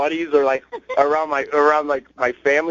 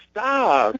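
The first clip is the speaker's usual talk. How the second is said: pitched-up girlfriend imitation; imitating needy girlfriend; 8 semitones higher than usual. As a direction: mimic her wounded protest, pitch up. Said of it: "but the way she says it".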